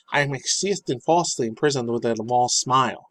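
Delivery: hammed-up imitation of his accent; mock the dude's accent, breaking into laughter; put-on accent, playfully exaggerated; Andrew Tate accent. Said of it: "I think that's how the dude sounds still".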